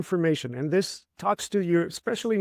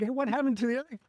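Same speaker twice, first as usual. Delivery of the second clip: put-on puzzled kid; 7 semitones higher, 3 dB quieter, faster than usual.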